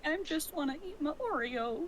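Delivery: Scar-like villain voice. Real, not acted. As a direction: sound like a sneering lion villain. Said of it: "why did that sound like something Scar would say".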